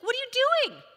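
loud, very high yell; mother yelling at the tutor, loud and high. Retold or imitated. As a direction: shout it in shock and anger. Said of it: "I start yelling at him now".

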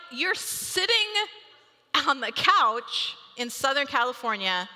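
ordinary talk

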